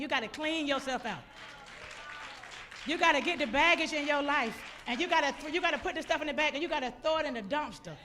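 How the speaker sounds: loud, emphatic preacher's charge; preaching exhortation. Real, not acted. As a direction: drive each phrase home to the congregation. Exhorts the congregation.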